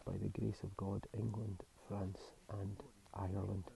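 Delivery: hushed, soft voice; quiet. Real, not acted. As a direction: keep it as quiet as you can. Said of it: "as quietly as I can".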